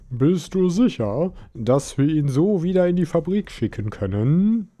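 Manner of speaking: doubtful king. A narrator's reading. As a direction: ask with royal doubt.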